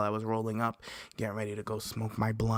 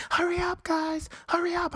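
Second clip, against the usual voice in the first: much higher, squealing hurry up; mock frantic yell. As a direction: imitate friends shrieking at you from the train. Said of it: "They're screaming".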